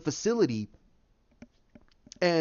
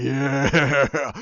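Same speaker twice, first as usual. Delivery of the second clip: drawn-out mock audience cheer; imitated crowd cheer; 3 semitones lower, 4 dB louder than usual. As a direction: cheer like a thrilled cinema crowd. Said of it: "everybody's like".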